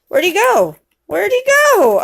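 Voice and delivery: high, sing-out calling; playful searching. Pretend. Parent hunting animal with child, high playful calling.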